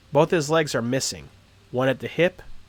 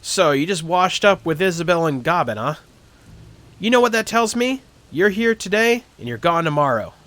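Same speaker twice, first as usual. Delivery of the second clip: sceptical gatekeeper; 6 semitones higher, 6 dB louder than usual. Acted. Gatekeeper voiced, high and louder.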